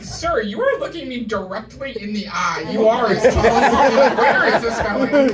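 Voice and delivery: loud, exasperated pushback at a creep; incredulous retort. Retold or imitated.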